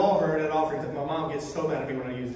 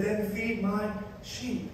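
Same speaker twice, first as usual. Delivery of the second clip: slow charge; 3 semitones higher, 7 dB quieter, much slower than usual. Acted. Jesus's final charge, soft and slow.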